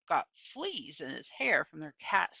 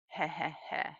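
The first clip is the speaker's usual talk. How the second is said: soft low chuckle from a distance; a quiet, knowing heh heh heh; old woman's chuckle; 3 semitones lower, 7 dB quieter than usual. A narrator's reading. Gloria chuckling unseen in the garden, soft. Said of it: "I heard".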